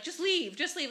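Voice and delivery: high, mock yelled just leave. Uber driver telling the neighbors to go, high mock yell. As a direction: wave them off like the driver. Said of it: "and he's like".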